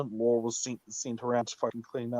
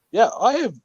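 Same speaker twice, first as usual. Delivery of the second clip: raised retort; 10 semitones higher, 8 dB louder than usual. Retold or imitated. Threatening the lazy cop.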